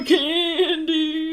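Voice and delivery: a lightly voiced old man, slow and high; hammed-up old geezer muttering threats; mock old man gamer. Husband jokingly voicing the YouTuber's old man.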